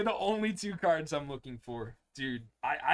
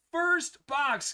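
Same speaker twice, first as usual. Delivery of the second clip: excited; 10 semitones higher, 5 dB louder than usual. Real, not acted. Hyped about box.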